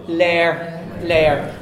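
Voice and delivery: lair with a long Ulster A; Ulster A sound. Shown as a demonstration. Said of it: "it's an A sound in Ulster".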